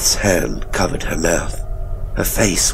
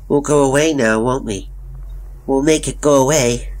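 put-on high-pitched, odd little boy; 8 semitones higher than usual. A narrator's reading. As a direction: voice a small boy promising escape. Narrator voices young Tommy.

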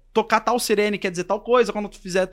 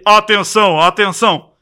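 loud booth-style announcement; imitated booming loudspeaker call; voice of God; 8 dB louder, slower than usual. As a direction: call for attention like an unseen announcer. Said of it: "uma voz de Deus".

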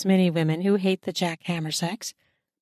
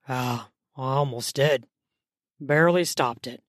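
strained male voice; 7 semitones lower than usual. A narrator's reading.

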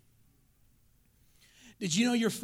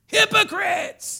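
very high, loud, drawn-out shout; meaner sermon rebuke; 12 semitones higher, 13 dB louder, much slower than usual. Retold or imitated. Preacher showing how mean Jesus' sermons were, shouted rebuke. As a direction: bellow it slowly as an accusation. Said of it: "That's what he said".

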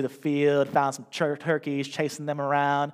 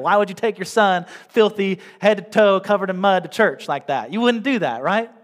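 rhetorical challenge; 6 semitones higher, 8 dB louder than usual. Acted. Pastor challenges congregation rhetorically.

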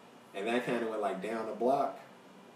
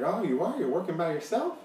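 high, louder nosy surprise; mock surprise; 7 semitones higher, 5 dB louder than usual. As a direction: imitate a stranger a bit too interested. Acting the creepy man's nosy surprise.

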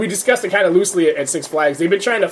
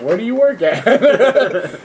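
laughing; 6 semitones higher, 4 dB louder, much slower than usual. Retold or imitated. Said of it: "He's like".

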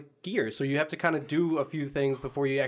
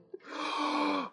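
sharp high gasp of horror; shocked gasp; 12 semitones higher, 4 dB quieter than usual. Real, not acted. Hearing dreaded game name.

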